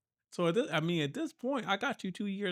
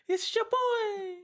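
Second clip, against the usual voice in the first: way higher, slow, squeaky; much slower than usual. Acted. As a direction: stretch it out in a squeaky falsetto. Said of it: "don't ever do that again".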